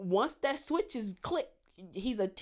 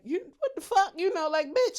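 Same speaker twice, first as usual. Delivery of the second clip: high-pitched, louder disbelief; mock outraged cussing; 8 semitones higher, 4 dB louder than usual. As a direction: act out an indignant outburst. Acting out cussing someone out.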